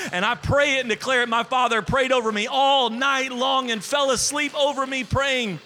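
loud, high, driving preacher's delivery; full-force preaching. Acted. Pastor preaching his healing story to the church, loud and fervent. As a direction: preach it out to the congregation with fire.

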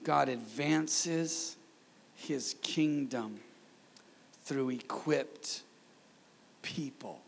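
very slow, phrase by phrase with pauses, softening on the last word; measured and weighty, trailing to a hush; slow, deliberate repetition. Real, not acted. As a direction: spell out the key point slowly, letting each phrase land. Repeats last point for congregation.

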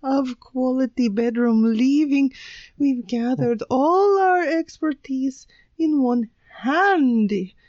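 put-on ad pitch in a dodgy Swedish accent; attempted Swedish accent. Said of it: "they weren't Swedish enough".

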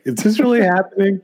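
can't believe what he's watching; high incredulous question; amazed disbelief. Retold or imitated. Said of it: "I was like".

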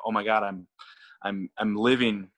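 ordinary delivery

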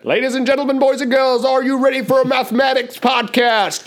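rally the crowd like a ringmaster; overbright kids party host. Acted. Announcing the section.

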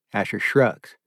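usual speaking voice

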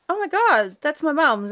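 high, startled exclamation; shocked discovery. Retold or imitated. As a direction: relive the sudden recognition. Recognising mum's belongings in shop.

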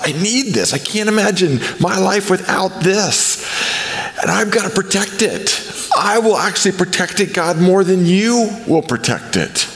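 high-pitched, clinging plea to God; insistent plea. Acted.

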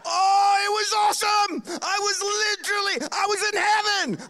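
bursting with delight at the memory; gleeful shouting; excited shout. Real, not acted. Delight recalling the voice crack.

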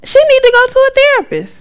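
hammed-up shrieking argument; high insistent retort. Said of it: "I said".

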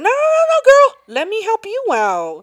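high, loud mock-helpful voice; hammed-up impression, swooping way up high; imitated security guy. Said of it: "Yeah, that was him".

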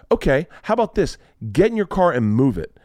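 high opening, then a firm order; retold bossy challenge. Parent ordering a teacher to move, bossy challenge.

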